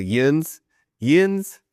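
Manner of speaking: "yins" drawn out as one syllable, YINZ; imitated East Tennessee "yins"; East Tennessee dialect. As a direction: say "yins" like a puzzling dialect word. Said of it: "happens East Tennessee too".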